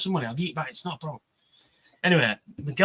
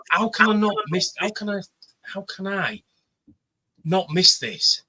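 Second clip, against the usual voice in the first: desperate; 5 semitones higher than usual. Retold or imitated.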